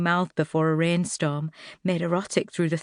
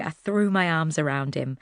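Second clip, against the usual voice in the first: plain reading voice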